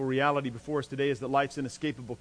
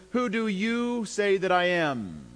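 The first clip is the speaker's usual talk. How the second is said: "you" stressed, slow and raised, falling away at the end; emphatic personal question; 4 semitones higher, slower than usual. Retold or imitated. Jesus puts the question to the twelve, slow, emphatic stress on "you". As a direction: lean slowly on "you", then let it fall. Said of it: "he puts it this way".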